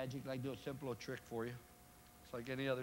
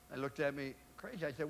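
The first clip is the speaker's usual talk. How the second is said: ordinary talk, nothing marked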